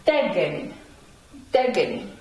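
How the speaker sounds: "Degeni" twice, De stressed, falling after; teacher repeating "Degeni" with the opening syllable strongest; upstress on first E. Shown as a demonstration. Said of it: "go above the first E".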